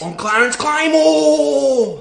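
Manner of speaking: loud, slow and proud; booming, slow self-introduction; said with chest. Acted. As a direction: declare your name with your whole chest. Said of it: "Say it with your fucking chest".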